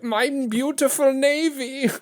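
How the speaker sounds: high, slow mock sob; imitating the crying Kaiser. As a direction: wail it like a heartbroken Kaiser, hammed up.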